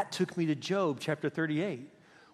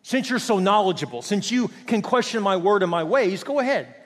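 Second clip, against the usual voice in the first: higher, louder mock deference; sarcastic God; 4 semitones higher, 5 dB louder than usual. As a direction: dare the know-it-all to take over. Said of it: "He's like".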